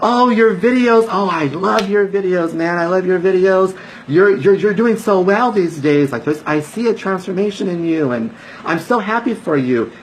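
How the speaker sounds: high, gushing friend voice. Retold or imitated. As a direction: play an old friend thrilled to see him. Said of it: "they look at me and they say".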